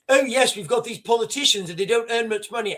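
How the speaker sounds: mock upper-class English lilt; lightly hammed-up plummy posh voice. Mocking Rory Stewart's posh tone about politicians. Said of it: "in a posh voice".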